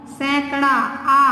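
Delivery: सैकड़ा आ said slowly, aa drawn out; stretched aa. Shown as a demonstration. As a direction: slowly draw out the final आ in सैकड़ा. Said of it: "आ का sound आ रहा है इसमें". (सैकड़ा का आ धीरे और लंबा खींचकर)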